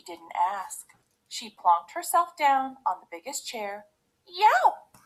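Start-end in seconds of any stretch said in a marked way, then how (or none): 4.3-5.0 high, loud yelp; pained cry. A narrator's reading. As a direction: yelp as if you sat on something hard. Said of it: "she cried".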